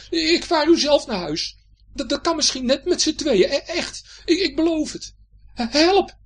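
high, loud stammering pleading; frightened pirate Hendrik. Acted. Hendrik begging Betje for mercy, frightened. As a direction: bargain desperately to stay aboard.

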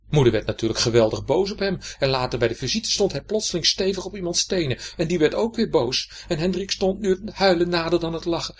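usual speaking voice

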